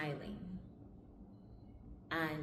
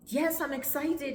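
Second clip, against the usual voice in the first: high, loud eager answer; excited reply; 9 semitones higher, 6 dB louder than usual. Retold or imitated. Daughter answers the visa official, excited. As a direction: act out an enthusiastic yes.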